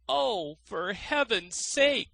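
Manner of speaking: high, slow pained cry. A narrator's reading. Scratched miser begging the fiddler, high pained cry. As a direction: plead from the thorns. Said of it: "cried the miser".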